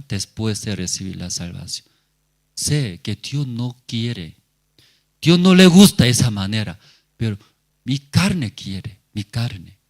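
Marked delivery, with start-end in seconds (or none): none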